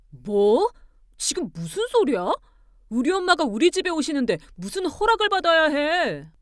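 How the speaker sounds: loud, incredulous outburst; building to a high, loud protest; indignant anger. Acted. Husband objecting to asking permission, indignant. Husband's angry retort on phone.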